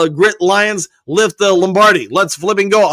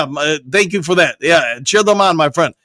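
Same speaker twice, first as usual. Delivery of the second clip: normal talking voice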